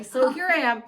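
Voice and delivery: usual speaking voice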